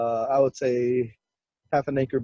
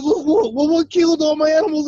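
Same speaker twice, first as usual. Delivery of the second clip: high-pitched imitation of an upset uncle; yelling demand; 12 semitones higher, 4 dB louder than usual. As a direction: demand answers over dead animals. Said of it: "he's yelling and screaming".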